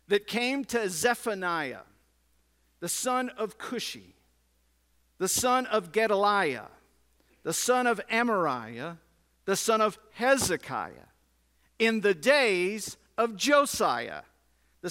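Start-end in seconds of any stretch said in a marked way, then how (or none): none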